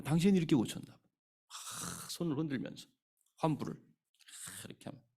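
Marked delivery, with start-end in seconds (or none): none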